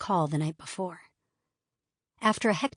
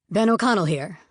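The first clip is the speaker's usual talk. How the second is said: gruff, louder official on the phone; gruff agent voice; 2 semitones higher, 5 dB louder than usual. Acted. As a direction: bark your name like a busy agent. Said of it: "the gruff voice said".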